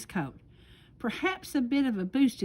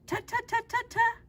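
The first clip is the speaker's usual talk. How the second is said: much higher mock-scolding tut-tuts; tutting; 13 semitones higher than usual. Fox interrupting beaver with high scolding tuts. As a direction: cluck disapprovingly, way up high.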